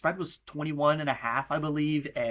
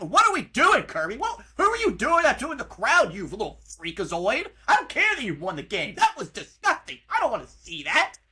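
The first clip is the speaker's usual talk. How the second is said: disgusted rant; 5 semitones higher, 4 dB louder than usual. Real, not acted. Yelling at Kirby Smart's gesture.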